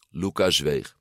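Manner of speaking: plain reading voice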